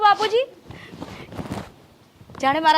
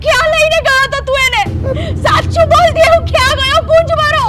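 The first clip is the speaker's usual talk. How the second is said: high, louder tearful demand; desperate mother pleading through tears; frantic crying; 10 semitones higher, 5 dB louder than usual. Acted. Mother demands where son went. (રડતાં રડતાં ગભરાટ)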